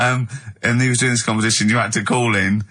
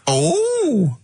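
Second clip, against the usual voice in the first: camp character voice, pitched higher; new comic character; slower than usual. Acted. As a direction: ham up a cheeky old character greeting the audience. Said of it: "he's a new character to me".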